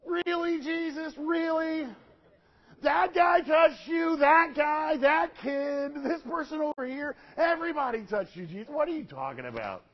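high, exasperated disbelief dropping at the end; incredulous protest. Acted. Disciples answering Jesus in the packed crowd, incredulous protest. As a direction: protest loudly as if the question is absurd.

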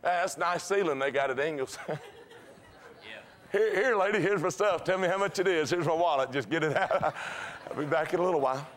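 a hint of a flustered shopper, laughing; mock avoiding eyes. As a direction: pretend to look away while hurrying. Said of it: "You walk through the aisle like this".

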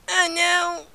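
high wail sinking into a whimper; whimpering dismay. Pretend. Player dismayed by a setback, whimpering.

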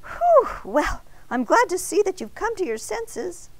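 high whew, then relieved chatter; huge relief. A narrator's reading. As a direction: let the worry out in a high voice. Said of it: "with a huge sigh of relief".

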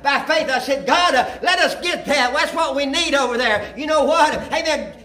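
high, loud preacher testimony; fervent preaching. Acted. Pastor telling the church how faith bought the property, fervent. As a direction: testify with rising fire.

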